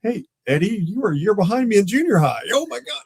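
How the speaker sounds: high, disbelieving reunion voice; a kid from junior high, now under your gun; excited recognition. Retold or imitated. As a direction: act amazed to see him here. Recognizing old schoolmate during raid.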